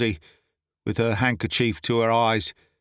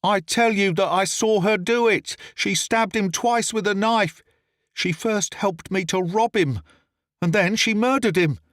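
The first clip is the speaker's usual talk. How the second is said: desperate pleading; 8 semitones higher than usual. A narrator's reading. Accused man pleads innocence.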